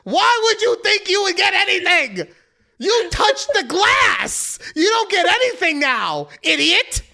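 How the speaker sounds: high, drawn-out angry tirade imitation; hammed-up furious shouting; mock yelling. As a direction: yell it like an over-the-top scolding. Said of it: "he's yelling at Charlie like".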